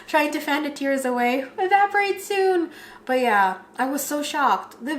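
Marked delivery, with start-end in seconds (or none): 0.1-2.7 high-pitched, half-laughing fight against tears; mock teary fluster. Bride about to cry in front of the mirror, mock flustered. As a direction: act out fanning tears away in a high voice. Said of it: "when I'm about to cry".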